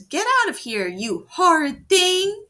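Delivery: high, loud cry of fright. Retold or imitated. Granny crying out at the wolf, high and loud. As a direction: shriek it at the wolf. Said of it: "she cried".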